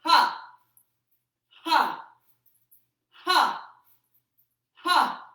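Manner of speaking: sharp loud Ha shouts; explosive breath-out shout; forceful shout. Shown as a demonstration. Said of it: "Here we go".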